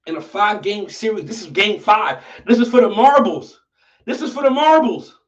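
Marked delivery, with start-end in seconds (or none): 1.2-5.2 louder, higher hype call; hype announcing. Real, not acted. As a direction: build up the stakes loudly. Hyping final battle game.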